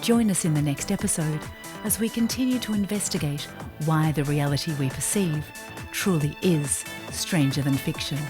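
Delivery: ordinary delivery, nothing marked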